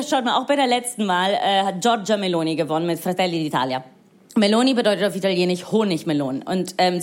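ordinary talk, nothing marked

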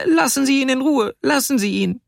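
put-on very high, pleading child's cry; high-pitched distressed protest; little girl near tears. A narrator's reading. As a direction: beg like an upset little girl. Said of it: "Sie weinte schon beinahe".

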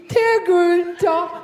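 slow, drawn-out call to a mate; a man at the urinal calling his pal over; mock urinal buddy.

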